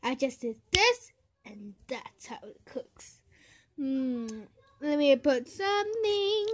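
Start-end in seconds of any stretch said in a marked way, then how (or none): 1.4-3.2 soft whispered aside. Real, not acted. Cook whispering to herself over the pan. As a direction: whisper it to yourself.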